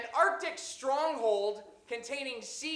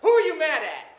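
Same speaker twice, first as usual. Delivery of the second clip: loud, high challenge to the crowd; indignant shout; 6 semitones higher, 16 dB louder than usual. Real, not acted. Host challenges the audience over a joke, indignant shout. Yells callback at groaning audience.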